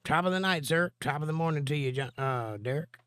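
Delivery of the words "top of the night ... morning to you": a lightly put-on Irish accent, pitched high; a playful hint of a stage Irish greeting; mock Irish brogue, half-hearted. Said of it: "I can do a pretty mean Irish accent".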